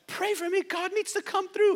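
high, needy plea; pleading friend. Pretend. Friend calling the pastor's listener for prayer, high and pleading. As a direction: beg like a desperate friend.

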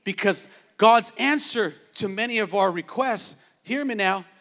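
normal talking voice

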